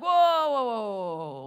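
loud whoas that settle down; jumping out of bed, pulling back on a panic; alarmed whoa. Retold or imitated. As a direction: stop yourself short, loud then easing off. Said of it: "and then I'm like".

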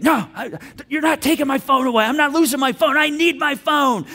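high, loud defiant protest; belligerent. Acted. Daughter refusing to give up her phone, belligerent. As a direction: dig in like a belligerent teen, stretching the last words. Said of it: "she becomes belligerent".

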